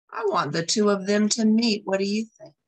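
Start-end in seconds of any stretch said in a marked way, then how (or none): none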